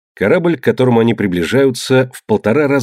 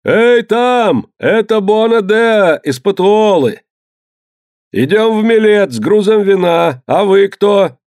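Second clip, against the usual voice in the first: much higher, loud hail; shouting across water; 10 semitones higher, 4 dB louder, slower than usual. A narrator's reading. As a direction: hail the other ship, far off. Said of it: "Капитан второй галеры крикнул".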